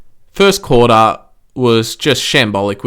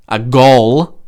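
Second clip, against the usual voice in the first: "A goal" with goal hit louder; stress on goal; 4 dB louder than usual. Shown as a demonstration. Podcaster stressing that it really was a goal, emphatic. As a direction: lean hard on "goal". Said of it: "Emphasis on goal".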